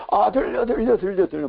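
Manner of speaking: high-pitched, frantic repeated cry; squealed imitation of a loud answer; imitated loud cry. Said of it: "큰 소리를".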